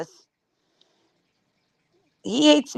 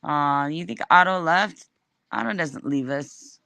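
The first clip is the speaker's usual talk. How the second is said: mock tender; 5 semitones lower, 3 dB quieter than usual. Mock sentimental eulogy for Otto.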